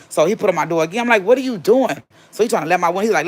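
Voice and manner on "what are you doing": high, sharp what are you doing; alarmed demand. Retold or imitated. Woman snapping at her ex through the door, high and sharp. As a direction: challenge him through the door.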